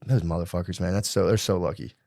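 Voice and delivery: low, soft grumble; hushed mock resentment; envious muttering. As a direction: mutter it enviously under your breath. Envious inner thought.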